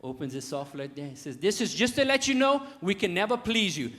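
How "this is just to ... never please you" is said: raised voice building louder; high, building mock announcement; declaimed punchline sign. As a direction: announce it loudly like a final verdict.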